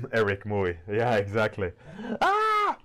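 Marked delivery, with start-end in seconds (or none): 2.2-2.8 sudden high shriek of alarm; panicked parent scream. Retold or imitated. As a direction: yelp out like a parent who thinks the kid is hurt. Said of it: "why is the parent screaming".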